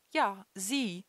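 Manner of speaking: a shaky, nervous call out; a nervous attendant calling out, voice trembling. A narrator's reading. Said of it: "rief der Tankwart mit zitternder Stimme".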